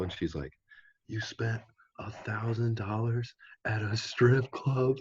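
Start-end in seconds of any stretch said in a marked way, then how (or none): 1.1-5.0 slow, higher-pitched imitation of an appalled mom; scolding mom. Friend retelling a mom's phone call about the strip club, mock scolding. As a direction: draw it out in mock maternal disbelief. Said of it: "his mom's like".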